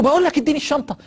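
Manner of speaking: high, loud snap for the bag; angry demand. Retold or imitated. Dying man snapping at the friend, high angry demand. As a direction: bark the demand up high in anger. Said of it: "يتعصب عليه".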